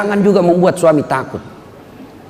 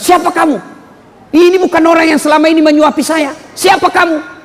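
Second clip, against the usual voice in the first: startled blind man; 10 semitones higher than usual. Retold or imitated. Blind man confronting the stranger feeding him, high and sharp. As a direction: push the stranger away with the question.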